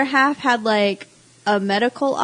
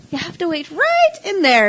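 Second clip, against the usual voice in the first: high voice; 6 semitones higher than usual. Retold or imitated. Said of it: "certain notes would be really high".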